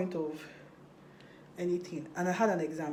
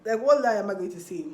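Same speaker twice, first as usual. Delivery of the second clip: said again much louder; louder repeat; 3 semitones higher, 10 dB louder than usual. Real, not acted. To viewers, repeating her worry loudly.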